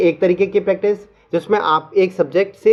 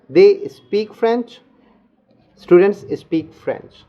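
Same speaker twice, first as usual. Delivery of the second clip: very slow, each word spaced out; slow drill; 2 semitones higher, much slower than usual. Shown as a demonstration. Teacher slowly drilling students in a sentence. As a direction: say it slowly so students can repeat. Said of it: "तो धीरे-धीरे बोलूँगा".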